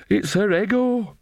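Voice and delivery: high, slow, feeble protest; weak. A narrator's reading. Nanny Ogg excusing Granny to Magrat, weakly. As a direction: offer it weakly, pitched well up. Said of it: "said Nanny Ogg weakly".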